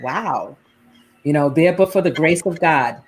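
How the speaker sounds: ordinary talk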